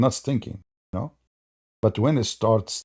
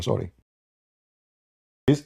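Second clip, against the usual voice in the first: normal talking voice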